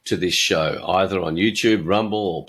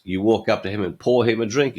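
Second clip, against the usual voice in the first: normal talking voice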